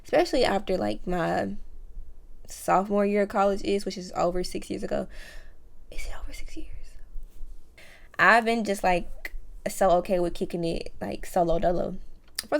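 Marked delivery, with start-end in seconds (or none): 5.9-6.7 whispered question to herself; half-whispered self-check, unvoiced; whispered aside. Real, not acted. Whispering to herself mid-story, unvoiced.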